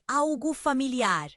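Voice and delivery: ordinary delivery, nothing marked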